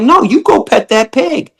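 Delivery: insist, raising the voice; insistent high urging. Retold or imitated. [name] insisting the visitor pet the pig, high and insistent. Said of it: "I'm like".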